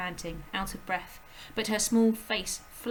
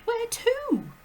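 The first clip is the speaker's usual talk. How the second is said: put-on high, urgent little girl's voice; a startled child calling out; high girl's cry; Matilda crying out; 8 dB louder than usual. A narrator's reading. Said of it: "Matilda cried".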